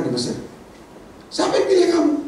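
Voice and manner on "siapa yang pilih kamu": high, laughing taunt. Acted. Crowd jeering at Moses, high and laughing. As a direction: jeer at him.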